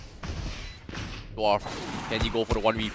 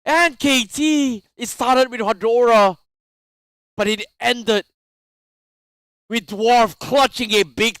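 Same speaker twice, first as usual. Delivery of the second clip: hyped replay commentary; esports caster; 8 semitones higher, 4 dB louder than usual. Real, not acted. Excited recap after clutch.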